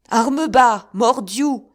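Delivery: very loud, high order; commanding shout. A narrator's reading. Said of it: "s'écria le capitaine aux soldats".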